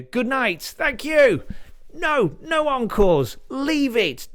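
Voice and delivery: high, loud showman waving off encores; excited stage sign-off. Pretend. Comedian waving off encores to an imagined crowd, high and loud. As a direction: play to a cheering crowd.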